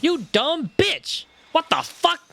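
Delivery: loud, high-pitched indignant cursing; outraged outburst. Real, not acted. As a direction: explode in disbelief at the game. Outraged gamer reacts to the theft.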